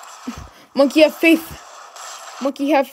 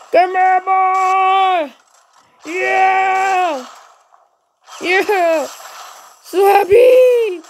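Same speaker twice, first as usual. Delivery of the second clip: slow, higher stretched-out cheers; happy drawn-out yells; 4 semitones higher, much slower than usual. Real, not acted. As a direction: stretch every word in pure glee. Rejoicing after getting weapon.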